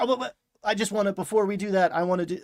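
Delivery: acting out an eager interrupting player; hasty interjection. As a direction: butt in quickly with a mock protest. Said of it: "if there is no interjection".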